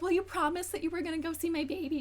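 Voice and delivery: high, whiny voice; mock whiny girlfriend. Girlfriend complaining he won't visit her kids, high and whiny. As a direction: whine it like a spoiled girlfriend. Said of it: "she's like".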